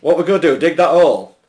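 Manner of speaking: put-on loud Yorkshire accent for a boar. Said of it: "He'd be from Yorkshire".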